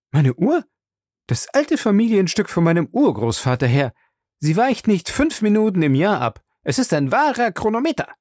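high-pitched, affronted servant; proud, indignant Passepartout. Acted. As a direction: defend a treasured heirloom with pride. Voices Passepartout, proud of watch.

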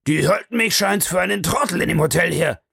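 enraged baronet shouting about the hotel; furious outburst. A narrator's reading. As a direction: spit it out in a rage. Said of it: "rief er".

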